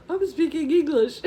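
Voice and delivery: high, embarrassed laughing answer. Retold or imitated. Tourist explaining the language to a stranger, laughing. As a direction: laugh through an obvious answer.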